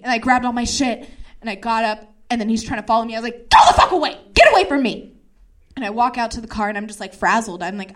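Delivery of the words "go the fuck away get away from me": loud, raised-pitch furious shouting; angry yell. Retold or imitated. Yelling at the man following her, furious. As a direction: yell it to drive someone off.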